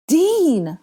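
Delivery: loud, high-pitched command; urgent order, drawn out and falling. Acted. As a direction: call out sharply to make a friend act. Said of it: "Hermione commanded".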